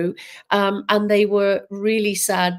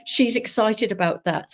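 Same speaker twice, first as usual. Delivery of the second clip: ordinary talk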